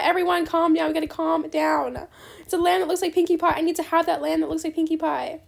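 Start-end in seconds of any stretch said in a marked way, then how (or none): none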